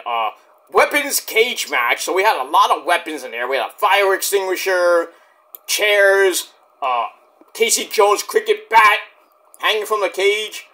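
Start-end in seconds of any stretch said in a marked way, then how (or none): none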